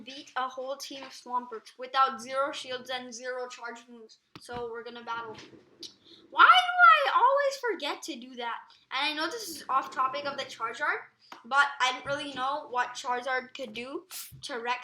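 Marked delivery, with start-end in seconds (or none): 6.3-8.6 exasperated shout. Real, not acted. Self-scolding outburst.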